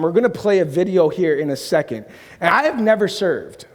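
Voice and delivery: usual speaking voice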